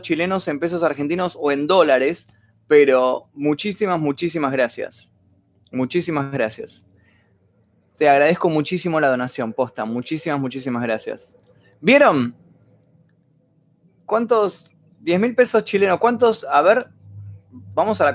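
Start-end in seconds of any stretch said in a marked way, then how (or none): none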